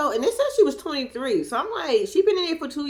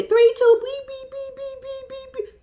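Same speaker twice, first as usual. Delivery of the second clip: phone beeps; 13 semitones higher, slower than usual. Retold or imitated. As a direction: imitate a phone tone. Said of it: "he was like".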